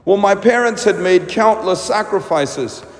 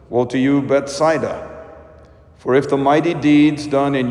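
solemn, lower liturgical proclamation; Gospel reading; 4 semitones lower than usual. Acted. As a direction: read it like a priest at the altar.

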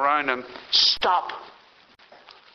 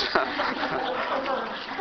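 short laugh; 7 semitones higher, 5 dB quieter than usual. Real, not acted. Laughing at own slip.

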